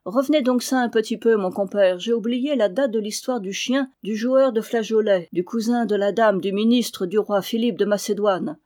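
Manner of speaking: usual speaking voice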